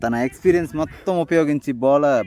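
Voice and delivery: lively play-by-play call; cricket commentary. Real, not acted. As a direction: call the match live.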